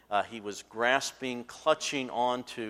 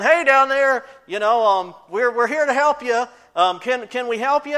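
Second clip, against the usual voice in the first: loud, much higher shout from above; yelling down; 13 semitones higher, 14 dB louder than usual. Retold or imitated. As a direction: call down from the helicopter. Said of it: "They yell down".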